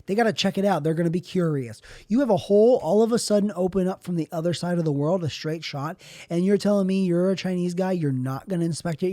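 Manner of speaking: normal talking voice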